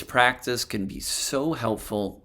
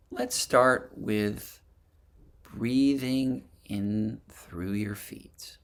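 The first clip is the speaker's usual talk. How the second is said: guided meditation; 3 dB quieter than usual. Acted. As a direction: gently lead them through a body scan, phrase by phrase. Leads a breathing and body scan meditation.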